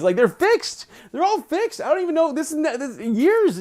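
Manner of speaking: high-pitched baffled protest; real exasperated bewilderment; incredulous. Real, not acted. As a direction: protest in sheer disbelief, pitch climbing. Baffled that fixed dogs hump.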